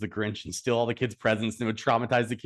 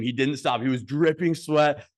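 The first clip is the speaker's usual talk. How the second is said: ordinary talk, nothing marked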